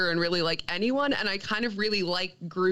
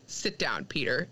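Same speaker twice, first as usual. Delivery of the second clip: low, restrained order; Beta Ray Bill's command; 8 semitones lower, 4 dB quieter than usual. Retold or imitated. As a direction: put him in his place in a lowered voice. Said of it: "he's just like".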